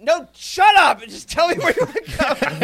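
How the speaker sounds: high, loud fed-up shout; exasperated yell. Retold or imitated. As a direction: snap at the friend, way up high and loud. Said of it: "It's like".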